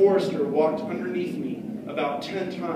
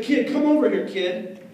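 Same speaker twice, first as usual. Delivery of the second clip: put-on bully calling the kid over, higher and louder; 7 semitones higher, 5 dB louder than usual. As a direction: call him over like a tough kid.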